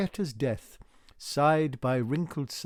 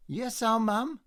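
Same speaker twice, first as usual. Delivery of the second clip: timid answer; 9 semitones higher, 4 dB louder than usual. A narrator's reading. Waldo answering meekly.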